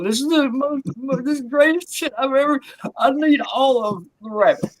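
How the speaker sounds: high-pitched sobbing gush, hammed up; imitating crying dealer. A comedian mimics his dealer crying over cat jerky, high mock sobs. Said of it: "and he was crying".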